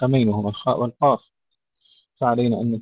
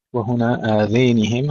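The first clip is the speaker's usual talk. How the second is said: ordinary delivery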